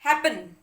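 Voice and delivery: Happen loud on है, falling away; emphatic teaching demo of happen, front-stressed; stress on first syllable. Shown as a demonstration. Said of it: "पहले वाले syllable पे आ रहा है".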